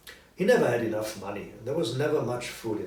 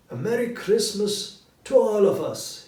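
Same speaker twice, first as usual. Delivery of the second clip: higher and louder festive toast; cheerful toast; 9 semitones higher, 8 dB louder than usual. A narrator's reading. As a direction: raise the glass with cheer. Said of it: "he said".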